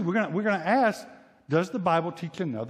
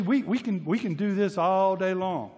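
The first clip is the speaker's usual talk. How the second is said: ordinary talk, nothing marked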